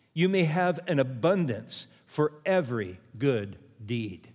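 measured scripture reading with weighty pauses; slow, deliberate reading. Real, not acted. Preacher reading a verse so it has an impact, slow and deliberate. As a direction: read it slowly, pausing between phrases. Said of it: "Let me read it slow".

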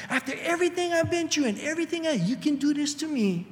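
high, fast aggrieved rant; bitter reproach. Retold or imitated. A bitter spouse listing grievances to their partner, high fast reproach. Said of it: "All the bitterness".

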